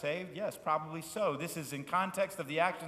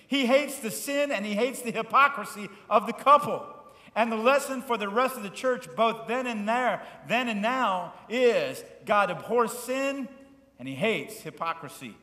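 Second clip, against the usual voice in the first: full-force preaching; 9 semitones higher, 10 dB louder than usual. Acted. Pastor warning the church about hypocrisy, loud preaching. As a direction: thunder out God's hatred of sin.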